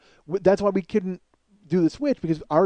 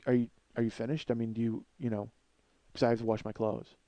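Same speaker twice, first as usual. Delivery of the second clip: low, very soft, imitated calm; politely wait out the tantrum; mimicking an unfazed, quiet neighbor; never raising his voice; 8 semitones lower, 10 dB quieter than usual. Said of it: "he never raises his voice".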